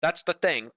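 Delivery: "thing" said with a k at the end, like "think"; mock British pronunciation. Shown as a demonstration. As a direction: mimic Brits hardening the end of "thing" to a k. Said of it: "in N G with a K at the end".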